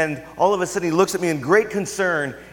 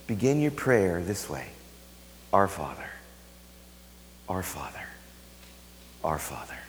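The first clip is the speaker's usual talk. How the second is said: much lower, softening with each Father; hushed prayer; 10 semitones lower, 8 dB quieter than usual. Acted. Jesus teaching disciples to pray, hushed reverent voice. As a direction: pray it quietly, sinking deeper each time. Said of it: "what does Jesus say".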